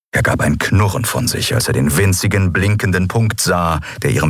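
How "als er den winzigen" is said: ordinary delivery, nothing marked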